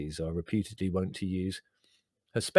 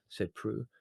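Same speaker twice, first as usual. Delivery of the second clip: plain reading voice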